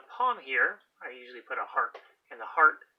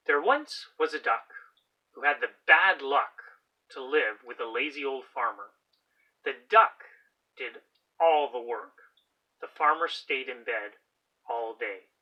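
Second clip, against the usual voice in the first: put-on loud, lively story narration for children; storybook read-aloud; 9 dB louder than usual. A narrator's reading. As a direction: read it out big for the class.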